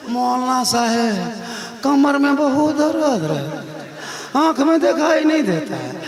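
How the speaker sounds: slow, drawn-out mock whine; complaining auntie. Auntie excusing herself from prayer to the maulana, mock complaining. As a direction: plead aches as a lightly voiced auntie. Acts auntie pleading ailments.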